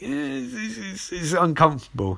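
pained, awkward cringe; uncomfortable squirm. Real, not acted.

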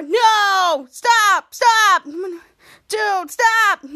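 loud protesting yells, rising to a cry; shouting stop. Retold or imitated. Henry yelling at Ray to stop fixing his hair, loud protest. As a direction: yell at him to stop, near tears.

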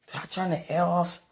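normal talking voice

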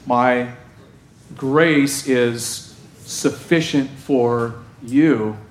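slow solemn Jesus. Acted. Voicing Jesus' reply to Paul.

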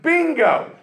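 high triumphant "Bingo!"; eureka shout. Retold or imitated. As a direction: pop it out like a sudden discovery. Sudden idea exclamation.

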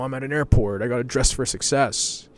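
lightly voiced smug business traveler; a hint of a self-important suit; mock business type. Mocking airport suits, a touch of smug swagger.

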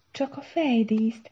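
slow, high, helpless correction; hapless stammer. A narrator's reading. Para correcting Szara about the headdress, haplessly faltering. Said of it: "hebegte szerencsétlenül Para".

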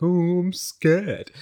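slow, timid put-on little voice; lightly hammed-up fright, very slow; mock scared. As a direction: play your shy teenage self, drawn out. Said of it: "I just stood there like".

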